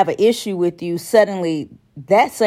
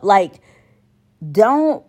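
normal talking voice

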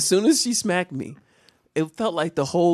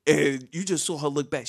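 usual speaking voice

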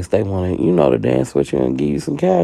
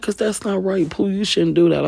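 whiny homeboy. Retold or imitated. Homeboy accusing Pooh of sleeping with his friend, high whiny pleading. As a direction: whine it up high like a hurt friend.

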